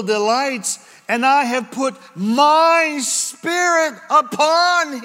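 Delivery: high, loud, slow proclaiming; ringing proclamation. Acted. Preacher proclaiming Isaiah to the congregation, high and loud. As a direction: declare it like a herald.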